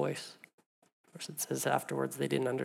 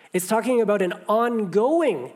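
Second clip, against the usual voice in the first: preaching emphasis; 6 semitones higher, 8 dB louder than usual. Real, not acted. Preaching climax.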